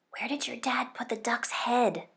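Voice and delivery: hushed whisper at the table. A narrator's reading. Bernard whispering to Sam at dinner, hushed whisper. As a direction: whisper so the parents can't hear. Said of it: "Bernard whispered to me".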